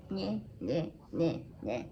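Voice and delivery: imitated waddle, short repeated nyehs; mock the strut with little nonsense sounds; comic sound effect of a heavy walk; waddling noise. Imitates big belly walking along.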